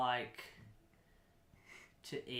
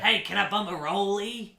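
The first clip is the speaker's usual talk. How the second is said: louder, rough bumming voice; hint of a chain smoker begging; 4 semitones higher, 5 dB louder than usual. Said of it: "E T sounds like a smoker".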